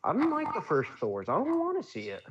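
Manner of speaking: high, complaining no; whiny high voice. Retold or imitated. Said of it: "I'm like".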